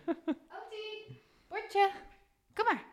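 high, soft pet-talk voice; talking to a dog. Real, not acted. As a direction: coax the dog to come. Calling the dog Ottie. (lief roepen naar de hond)

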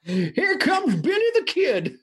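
put-on cackling old-timer calling the showdown; old prospector. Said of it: "It's the old prospector".

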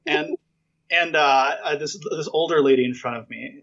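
usual speaking voice

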